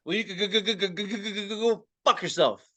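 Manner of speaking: purposeful stutter. Pretend. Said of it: "purposeful stutter".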